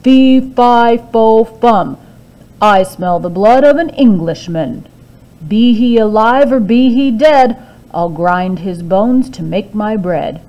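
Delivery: loud giant chant; the giant sniffs out Jack; booming giant. A narrator's reading. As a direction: boom it like a hungry giant. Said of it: "and he said".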